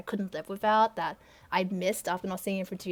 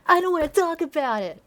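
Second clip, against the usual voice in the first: louder, higher, pained refusal; upset refusal; 6 semitones higher, 9 dB louder than usual. Acted. Phil refusing to talk about his past, upset. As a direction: snap it out, refusing to discuss.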